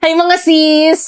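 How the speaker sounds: slow, high put-on sosyal greeting; gushy hello. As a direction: greet the girls like a socialite. Gushing greeting to friends. (maarteng bati sa mga sis)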